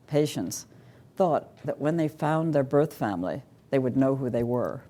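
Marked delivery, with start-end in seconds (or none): none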